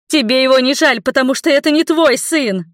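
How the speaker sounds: high, loud accusation; angry shout. Acted. Mother yells at her lover carrying her off, angry shout. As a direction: hurl the blame at him.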